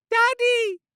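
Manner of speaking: put-on very high, loud little girl's call; child crying out. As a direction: call out to her father joyfully. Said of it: "Renice cried out".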